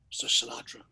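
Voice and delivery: hushed whisper. Retold or imitated. Young singer quietly asking [name] after the show, whispered.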